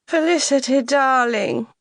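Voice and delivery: high, loud, gushing society greeting; effusive mother. Acted. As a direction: sweep in and greet your daughter grandly. Mother greets her arriving daughter effusively.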